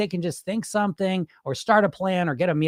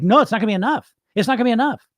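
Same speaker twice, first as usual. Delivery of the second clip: mock panicked inner voice, higher; freaking out; 6 semitones higher, 4 dB louder, faster than usual. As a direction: act out a mild freak-out. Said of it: "then I start freaking out like".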